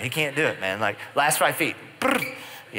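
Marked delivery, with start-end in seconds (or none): 2.0-2.3 imitated noise. Imitating a sudden stop noise.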